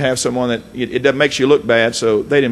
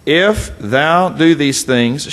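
kata "if" ditekan dan ditarik pelan; stress on if; much slower than usual. Shown as a demonstration. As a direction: lean slowly on "if". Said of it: "notice the if".

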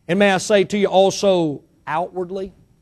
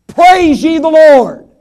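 "Praise ye the Lord" drawn out slowly, higher and louder; slow, ringing "Praise ye the Lord"; emphatic proclamation; 6 semitones higher, 8 dB louder, much slower than usual. Shown as a demonstration. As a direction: proclaim it with full force. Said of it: "he's emphatic about that".

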